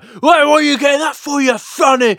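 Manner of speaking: high, loud jeering mate; mocking a spice snob. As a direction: jeer at their mild curry. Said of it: "they'd be like".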